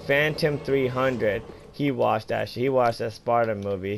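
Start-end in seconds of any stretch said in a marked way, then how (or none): none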